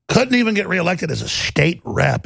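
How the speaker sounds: ordinary talk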